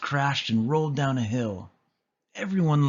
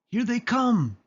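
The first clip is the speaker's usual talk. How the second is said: high, loud alarm call; puppy lookout shouting a warning; 12 semitones higher, 3 dB louder than usual. A narrator's reading. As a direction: sound the alarm to the others. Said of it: "shouted Tibbs".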